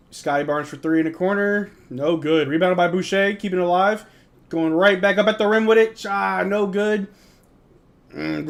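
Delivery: lively mock broadcaster call; amateur sportscaster; play-by-play. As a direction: call the action like a TV commentator. Calls the game play-by-play.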